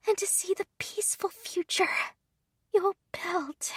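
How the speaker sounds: put-on faint, high, breathless whisper of a dying girl; fading gratitude, barely voiced; dying young maid. Dying maid's farewell.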